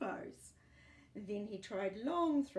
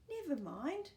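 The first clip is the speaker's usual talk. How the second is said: reassuring; 6 semitones higher, 3 dB louder than usual. A narrator's reading. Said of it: "said the girl".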